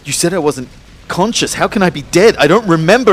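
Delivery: losing it at Laura, voice climbing; raising his voice. Acted. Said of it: "realizing I was raising my voice".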